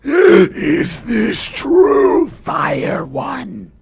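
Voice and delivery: gasp, then draw the question out eerily; creature voice. Acted. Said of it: "looks at Jamila and says".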